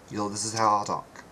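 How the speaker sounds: normal talking voice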